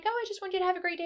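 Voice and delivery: fast, high mock-sweet husband reply; imitating sweet husband. Wife imitating her husband's sweet answer, high and fast.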